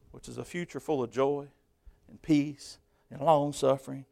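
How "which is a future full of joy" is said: usual speaking voice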